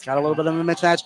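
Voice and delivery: ordinary talk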